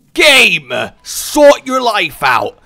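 loud, slow exasperated yell; frustrated shout at the game. Real, not acted. Streamer yelling at the game over bad items, loud and slow. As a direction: bellow it at the screen, fed up.

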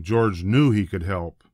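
usual speaking voice

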